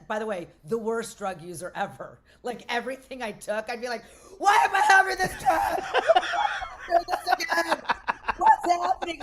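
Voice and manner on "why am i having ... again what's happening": high, loud freak-out through laughter; mock panicked shout. Drug user reliving every bad trip, mock panicked shout. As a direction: cry out in hammed-up panic, laughing.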